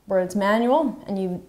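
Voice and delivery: usual speaking voice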